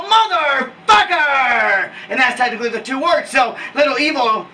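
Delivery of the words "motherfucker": big wrestling catchphrase yell; catchphrase holler. Shown as a demonstration. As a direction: stretch both halves out as a hype call. Demonstrating his shouted catchphrase.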